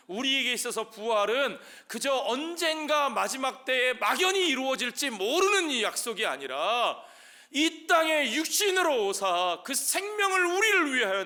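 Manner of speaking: high, loud, surging proclamation; impassioned preaching. Real, not acted. Pastor proclaiming resurrection to the congregation, impassioned and loud. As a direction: preach it at full force, voice lifted high.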